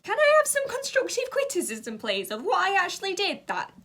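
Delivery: very high, mock sweet request; sarcastic plea. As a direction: needle them with fake politeness. Sarcastic retort to couple.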